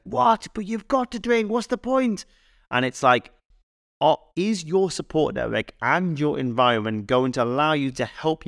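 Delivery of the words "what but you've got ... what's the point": high incredulous mock friend voice; pushy friend. Friend pushing a dieter to drink, high mock disbelief. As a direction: squeal it like a disbelieving mate. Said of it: "their friend's like".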